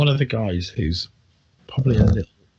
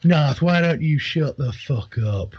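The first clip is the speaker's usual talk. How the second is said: thug taunting; 5 semitones higher than usual. Acted. Red band thug leader sneers at old Narth. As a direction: taunt the old man from the porch.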